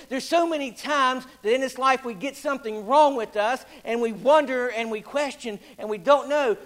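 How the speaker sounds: full-force revival preaching. Acted. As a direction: preach it out to the congregation. Preacher in full sermon delivery.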